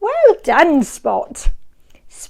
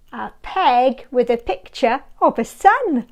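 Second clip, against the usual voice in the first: plain reading voice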